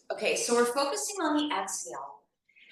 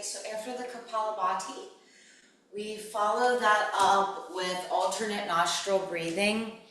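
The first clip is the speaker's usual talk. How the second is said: usual speaking voice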